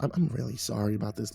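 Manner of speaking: softly stammered, apologetic; meek, halting sorry; stammered apology. A narrator's reading. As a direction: trip gently over the apology, voice dropped. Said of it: "I held up my hands and stammered".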